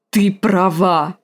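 slow, drawn-out growl. A narrator's reading. Scarred warrior grabs the girl and agrees, growling. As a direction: growl it out heavily and slowly. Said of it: "прорычал он".

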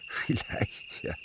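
breathy little laugh through the word; laughing softly. Acted. Uncle amused by the boy's mother, soft laugh. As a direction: chuckle it away.

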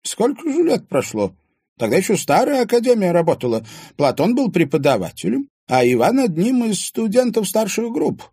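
put-on high, loud old woman's voice; old woman telling the past; reminiscing old woman. A narrator's reading. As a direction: recall old times.

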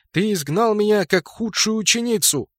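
high-pitched, aggrieved outburst; bitter accusation. A narrator's reading. Banished pupil accuses her teacher, high and bitter. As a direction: hurl an old grievance at the teacher.

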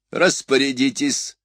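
high, drawn-out command with a touch of bark; barked order. A narrator's reading. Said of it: "рявкнул император". (приказной окрик, слегка рявкая)

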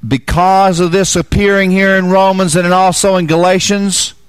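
high, loud fervent preaching voice; full-force preaching. Acted.

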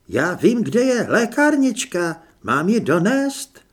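high, loud, lightly voiced helper; patient helper. A narrator's reading. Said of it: "dodal trpělivě".

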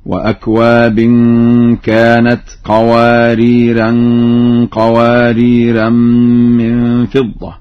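قواريرا as "qawariran" both times; slow recitation, nasal ending on each قواريرا; both with tanween. Shown as a demonstration. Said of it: "قرأ ورش اللفظين معا بالتنوين". (قواريرا بالتنوين في الموضعين)

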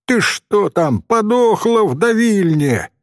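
high-pitched yell from afar; acted shouted scolding, moderate volume; impatient holler. A narrator's reading. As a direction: call out crossly, as if from a tree. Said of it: "заорал он с дерева".

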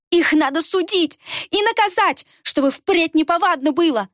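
high, shrill demand for judgment; spiteful demand. Acted. The pockmarked girl demands judgment, high spiteful voice.

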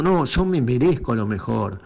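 steady, assertive correction; self-assured. Acted. Showing listeners how to claim their worth, self-assured. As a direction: state it as a settled fact. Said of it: "no lo pidas de un lugar de carencia".

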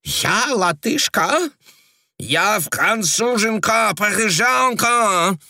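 very high, slow outraged cry with French burr; angry cry. A narrator's reading. Frenchwoman outraged at the agent, furious burred cry. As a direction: exclaim in fury, rolling the r. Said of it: "гневно, грассируя, воскликнула мадам".

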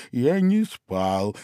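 slow, hoarse-from-sleep Dormouse; a groggy Dormouse protesting; sleepy Dormouse. A narrator's reading. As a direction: drag it out, half asleep. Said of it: "хриплым от сна голосом".

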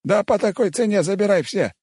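high put-on old man exclaiming; old cook happily giving in; excited old cook. Cook gives in to offer.

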